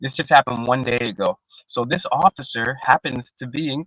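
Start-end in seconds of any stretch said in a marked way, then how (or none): none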